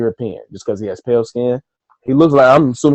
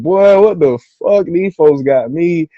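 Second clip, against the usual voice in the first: slow, louder and higher mock despair; dismayed disbelief; 5 semitones higher, 5 dB louder, slower than usual. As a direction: drag it out like you can't believe your luck. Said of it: "I said".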